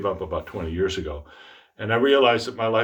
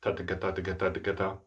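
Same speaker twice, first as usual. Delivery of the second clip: quick, softer "ta, ta, ka" in even threes; triple tongue pattern; 2 semitones lower, 7 dB quieter, faster than usual. Shown as a demonstration. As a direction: run "ta, ta, ka" together evenly. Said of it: "The syllables I gave you were ta".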